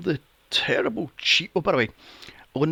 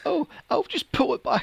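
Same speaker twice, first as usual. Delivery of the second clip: a hint of a higher, breezy Ellen; light mock Ellen; 10 semitones higher than usual. Lightly voicing Ellen taking the stick. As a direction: voice her casually. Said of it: "And she goes".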